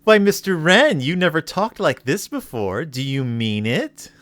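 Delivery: high mock-feminine voice, settling by the end; coy, giggling woman. Host voicing Miss Millick answering Mister Wren, high and coy. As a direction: put on a high girlish titter and tease. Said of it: "And then she says".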